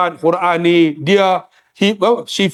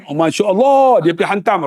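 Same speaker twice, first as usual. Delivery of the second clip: normal talking voice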